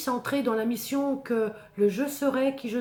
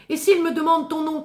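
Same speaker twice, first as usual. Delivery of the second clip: anxious plea; 7 semitones higher, 8 dB louder, faster than usual. Acted. Moses anxiously questions God.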